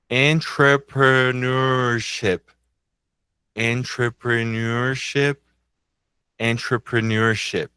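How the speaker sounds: drawn out slowly, syllable by syllable; slow demonstration. Shown as a demonstration. Teacher saying a hard English word for learners, slowly. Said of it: "Eu vou falar devagar".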